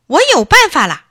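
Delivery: put-on high, loud triumphant boy's cry; excited idea. Boy shouting that he has found a way, high and loud. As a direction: burst out with the bright idea.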